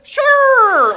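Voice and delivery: very high, loud drawn-out yelp; hammed-up frantic agreement; panicked "sure". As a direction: squeal it in mock panic. Acts out panicked answer.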